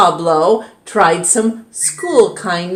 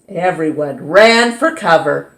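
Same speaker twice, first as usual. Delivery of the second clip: ordinary delivery, nothing marked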